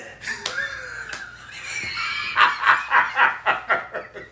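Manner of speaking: squealing burst of laughter; high shrieking laugh. Real, not acted. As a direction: cackle up high. Laughing at own accent bit.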